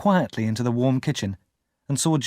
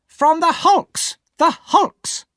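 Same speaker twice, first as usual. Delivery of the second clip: very high, loud, slow exasperated cry; impatient shout; 6 dB louder, much slower than usual. Acted. Sister exasperated at Pip's questions, impatient shout. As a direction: fling the answer at him.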